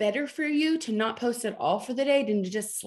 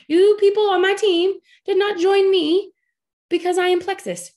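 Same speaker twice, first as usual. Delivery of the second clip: emphatic; 9 semitones higher, 7 dB louder than usual. Real, not acted. Emphatic call-out to her team.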